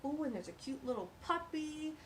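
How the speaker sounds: ordinary delivery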